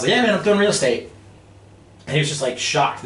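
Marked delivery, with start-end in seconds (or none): 0.1-1.1 high, loud, proud comeback; excited boast. Retold or imitated. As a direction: brag it right at the boss. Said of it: "I was so excited".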